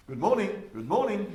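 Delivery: loud, high repeated greeting; insistent wake-up call. Acted. Someone waking you up in bed, loud high call. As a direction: call it out to rouse a sleeper. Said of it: "do it by saying".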